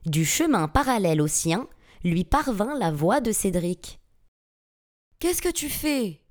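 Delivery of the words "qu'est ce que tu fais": pitched-up, alarmed protest; alarmed cry. A narrator's reading. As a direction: cry out as if overheard from afar. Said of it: "s'écria celui-ci".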